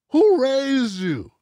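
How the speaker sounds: high, slow, drawn-out comic roast; TK Kirkland catchphrase voice. Retold or imitated. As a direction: stretch it out like a stand-up roasting someone. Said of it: "Shout out to T K Kirkland".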